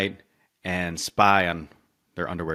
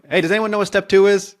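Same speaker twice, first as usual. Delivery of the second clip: higher, louder call to the other gnomes; 13 semitones higher, 6 dB louder than usual. Retold or imitated. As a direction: call out to the group, lost. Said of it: "turns to another one".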